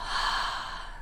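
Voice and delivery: breathy ujjayi exhale like ocean waves; ocean throat breathing. Shown as a demonstration. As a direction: fog a mirror with a throaty exhale. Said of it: "it sounds kind of like the waves of the ocean".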